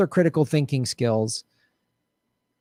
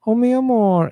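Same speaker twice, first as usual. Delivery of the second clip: mock Latin lover accent; 8 semitones higher, 3 dB louder than usual. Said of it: "That accent".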